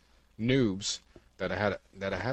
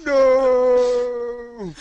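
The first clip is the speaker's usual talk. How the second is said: dramatic shout; 14 dB louder than usual. Pretend. Dramatic cry.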